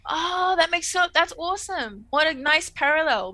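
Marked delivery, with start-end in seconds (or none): 0.1-2.1 higher, louder delighted exclaim; light up at the idea; excited realisation. Real, not acted.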